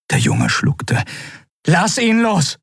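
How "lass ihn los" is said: high, urgent order; commanding. A narrator's reading. Endriel orders Keru to free Kai, commanding. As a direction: order it sharply, pitch raised. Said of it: "befahl Endriel".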